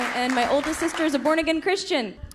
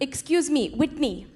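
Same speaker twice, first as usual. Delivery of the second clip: bossy Indian mom. Retold or imitated. Mom bossing flight attendant.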